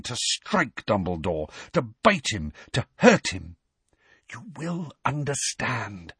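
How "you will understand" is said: softer, slow half-whisper; a gentle parting whisper. A narrator's reading. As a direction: breathe it out slowly and softly. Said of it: "whispered Dumbledore".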